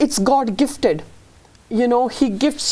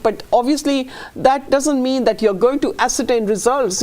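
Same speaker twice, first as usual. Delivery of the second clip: ordinary talk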